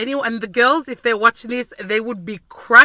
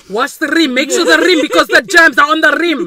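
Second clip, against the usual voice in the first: loud, higher fussy-dad nagging; louder mock lecture on germs; imitating fussy dad; 6 semitones higher, 10 dB louder than usual. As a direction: nag about the rim like a clean-freak dad. Imitating dad's dish rules.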